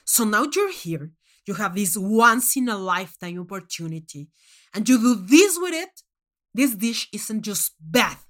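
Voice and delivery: loud furious scolding, building to a roar; furious tirade. Acted. Judge Manx scolding the contestant for a lazy dish, loud and furious. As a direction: berate her like she insulted you.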